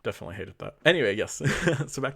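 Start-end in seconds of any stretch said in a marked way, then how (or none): none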